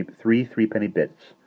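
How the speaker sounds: ordinary delivery, nothing marked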